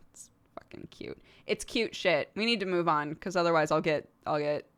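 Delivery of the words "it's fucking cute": a real whispered aside. Real, not acted. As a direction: breathe it out as a hushed whisper.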